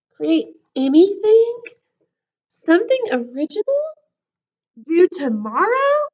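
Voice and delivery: high, loud panicked questions; disbelief. Acted. Cookie panicking about the homework, high disbelieving questions.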